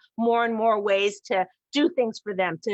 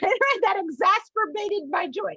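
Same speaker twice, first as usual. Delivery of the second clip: laughing; 10 semitones higher than usual. Real, not acted. Laughing over the word game.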